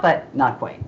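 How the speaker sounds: ordinary talk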